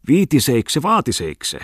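high, loud tipsy exclamation; exclaimed. Acted. Bungman shouting his catchphrase at the gentlemen, exclaimed loudly.

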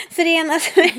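high, loud and amused; laughing through words. Real, not acted. Explaining life without an indoor toilet, laughing through words.

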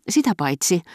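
high-pitched, enraptured aside; mumbled rapture. A narrator's reading. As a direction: start the gushing afterthought up high. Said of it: "mutusteli markiisitar vielä kerran hurmioissaan".

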